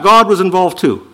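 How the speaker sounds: usual speaking voice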